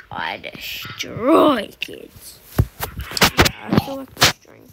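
slow, breathy mock-villain boast; mock menace. Streamer boasting to chat he'll destroy kids, mock menacing. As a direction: savour the threat, hammed-up.

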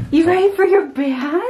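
high cooing pet voice. Real, not acted. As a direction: coo at the puppy. Talking to dog before bath.

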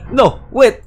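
loud, high panicked cry to stop her; frantic high yell of no, wait; desperate shout. Acted. Character begs attacker to stop.

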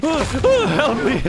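loud, high laughing yell; mock cry for help. Gamer in trouble calling to friends, mock cry for help. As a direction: yell it in playful panic.

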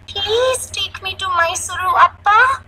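put-on high-pitched child begging Dad; pleading little girl. Little girl pleads with her father.

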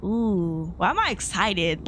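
usual speaking voice

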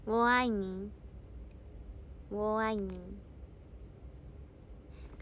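slow, drawn-out Mandarin syllables; 一字一字慢慢说; careful Chinese. Shown as a demonstration. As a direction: spell out ウォーアイニー slowly in Mandarin. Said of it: "ニーハオ".

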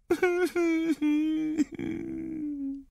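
high, soft whimpering cry; acted child's sobbing wail; little boy crying. A narrator's reading. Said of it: "The little boy began to cry".

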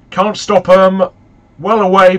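loud, urgent burst at the screen; real jolt of excitement, loud; excited shout. Real, not acted. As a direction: yell it as the shot flies in. Live reaction to a near goal.

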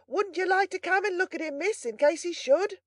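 high, loud servant's call; carrying bawl. A narrator's reading. Servant reporting on the wounded boy to miss, high bawling. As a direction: bawl it up the stairs. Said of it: "bawled Brittles in the same manner".

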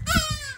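very high, loud parrot squawk; sharp shrill bird cry; indignant squawk. Acted. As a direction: screech like an offended parrot. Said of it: "an indignant squawk".

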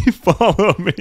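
drawn out, high and loud through laughter; laughing follow me. Real, not acted. Streamer leading chat through the level, laughing high call. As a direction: giggle it slow and high. Laughing sing-song repeat.

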